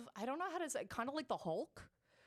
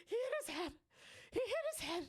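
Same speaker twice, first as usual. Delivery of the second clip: high, tearful pleading, voice pitched way up; retold through tears. Babysitter telling the parents what happened, tearful and high. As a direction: blurt it out as if crying to the parents. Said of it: "in tears".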